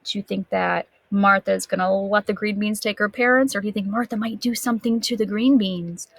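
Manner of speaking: plain reading voice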